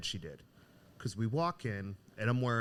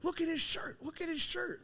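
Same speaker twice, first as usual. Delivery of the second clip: retold yell. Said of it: "the guy behind the counter yells".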